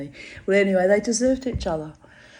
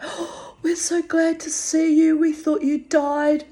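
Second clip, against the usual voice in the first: much higher, airy-then-loud delight; imitating gushing staff; 10 semitones higher, 4 dB louder than usual. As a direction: gush like relieved guards seeing a regular return. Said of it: "And they were like".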